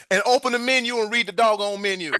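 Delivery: high-pitched, pretend telling-off; mock exasperated scolding. Host to absent guest, mock exasperated.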